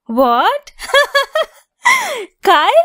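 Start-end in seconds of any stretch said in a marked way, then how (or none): 1.8-2.4 a sudden, much higher squeal of laughter; shocked burst of laughter. Acted. Friend suggests Kyle as the crush, burst out laughing. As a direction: laugh out in disbelief at the guess.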